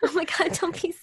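high, emotional, near tears; tearful comforting. Real, not acted. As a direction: comfort her while tearing up. Co-host comforts crying friend.

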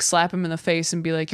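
normal talking voice

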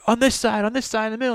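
high, pumped crowd warm-up call; hype host call-out. Retold or imitated. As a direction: rile up the crowd from the stage. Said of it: "you came out and you were like".

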